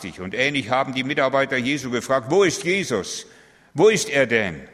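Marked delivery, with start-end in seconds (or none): 2.3-4.7 anxious crying out. Acted. Disciples ask desperately.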